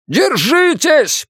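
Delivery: high, drawn-out driver's yell; urgent stretched cry at high pitch; shouted warning. A narrator's reading. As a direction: shout to brace the passengers. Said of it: "прокричал Йонас".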